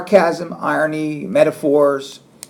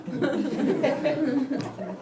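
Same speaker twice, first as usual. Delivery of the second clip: laugh; 12 semitones higher, 7 dB quieter than usual. Real, not acted. Laughing at own sarcastic joke.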